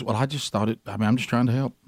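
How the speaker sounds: meek wife excuse. Retold or imitated. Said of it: "you know what she always says".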